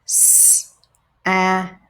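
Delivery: "S-A-T" sound by sound, separated; segmented sounds. Shown as a demonstration. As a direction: break "S-A-T" into its sounds. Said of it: "breaking words apart into their sounds".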